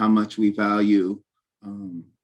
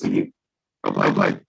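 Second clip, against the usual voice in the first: robot voice; 14 semitones lower than usual. Real, not acted. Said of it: "you just started doing it".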